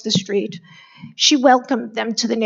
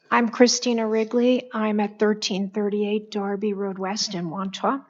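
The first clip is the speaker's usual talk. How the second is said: ordinary talk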